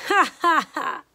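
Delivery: loud sly weasel cackle; villain laugh. A narrator's reading. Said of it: "Old Mister Weasel laughed out loud".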